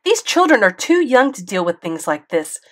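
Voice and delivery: loud, high angry tirade; mother ranting at the school board, loud fury; furious rant. A narrator's reading. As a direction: tear into the principal. Mom keeps ranting.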